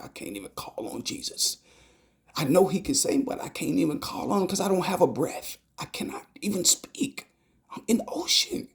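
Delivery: rising panic, breath running out; desperate inner voice. Retold or imitated. Retelling the drowning dream, voicing rising panic.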